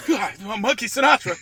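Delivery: startled high cry; mock panic. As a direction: jolt awake in fake terror. Mock waking from nightmare.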